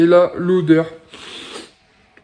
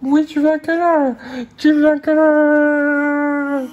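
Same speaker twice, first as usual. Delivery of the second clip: higher, stretched slow fond coo; 6 semitones higher, slower than usual. Real, not acted. Parent offering baby a cuddle, tender teary coo. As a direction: coo it slowly.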